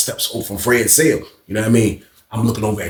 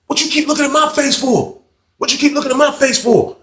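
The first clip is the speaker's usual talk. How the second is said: higher aggressive challenge; retold challenge; 11 semitones higher than usual. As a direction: imitate the barber picking a fight. Barber picks a fight.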